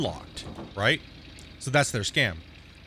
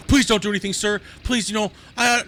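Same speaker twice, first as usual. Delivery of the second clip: higher, louder begging voice; mock pleading; 7 semitones higher, 5 dB louder than usual. Imitating a scammer begging him not to act, high and pleading. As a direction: beg like a scammer falling apart. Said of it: "he started breaking down".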